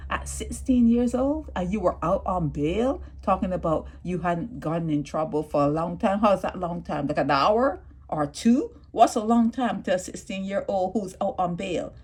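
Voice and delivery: ordinary talk, nothing marked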